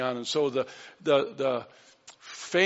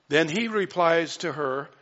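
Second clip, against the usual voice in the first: ordinary talk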